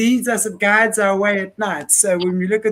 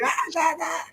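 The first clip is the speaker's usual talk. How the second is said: high, mock wail of protest; scolding ancestor; 7 semitones higher, 7 dB quieter than usual. Imagined ancestors telling the speaker off, mock high protest. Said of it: "my Afrikaans ancestors telling me".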